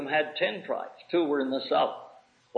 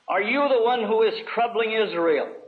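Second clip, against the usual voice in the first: raised, pointed accusing question; quoted challenge; 7 semitones higher, 4 dB louder than usual. Acted. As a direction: read the king's challenge higher, pressing the question. Ahab confronts Elijah, quoted.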